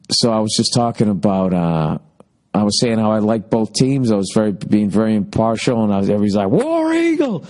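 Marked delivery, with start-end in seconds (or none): 6.5-7.4 high mock stadium chant; imitating screaming football fans. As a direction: yell it like rowdy college fans. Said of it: "and screaming".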